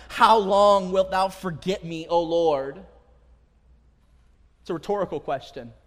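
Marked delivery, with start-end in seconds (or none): 0.1-2.8 crying out. Shown as a demonstration. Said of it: "the psalmist cries out".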